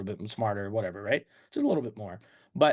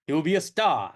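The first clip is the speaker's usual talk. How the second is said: louder, higher fifties Brooklyn mogul pitch; Brooklyn film-producer accent; 4 semitones higher, 4 dB louder than usual. Acted. Film producer promoting his star, in a brash Brooklyn accent. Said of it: "an accent".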